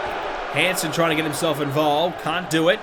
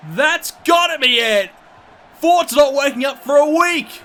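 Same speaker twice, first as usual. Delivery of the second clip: wrestling commentator; 7 semitones higher than usual. Real, not acted. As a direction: yell every move as it lands. Near fall, frantic match calling.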